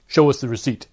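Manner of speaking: a loud, curt guard's order; guard orders the passenger to show the receipt, curt; curt demand. Acted.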